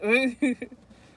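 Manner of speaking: sudden loud cackle; a big scornful laugh; loud burst of laughter. Real, not acted. Laughing at ex's message.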